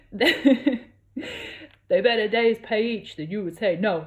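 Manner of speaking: put-on unplaced accent, slow and loud; Fiero's voice. A narrator's reading. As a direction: draw it out like a cheeky confession. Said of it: "I don't know what accent Fiero is".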